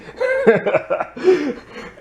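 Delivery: bursting into a high-pitched laugh; a squealing laugh at the silly scene. Real, not acted. Laughing at the creepy line.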